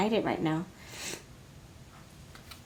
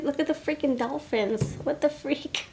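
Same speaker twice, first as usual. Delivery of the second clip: giddy amazement; 6 semitones higher than usual. Real, not acted. Giggly amazement at the dolphin cards.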